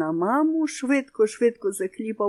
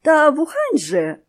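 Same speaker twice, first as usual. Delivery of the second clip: high, louder, slow flustered plea; flustered babble; 8 semitones higher, 8 dB louder than usual. A narrator's reading. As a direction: stammer it out in confusion. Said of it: "розгублено пролепетала Зайчиха". (розгублено, високо, повільно)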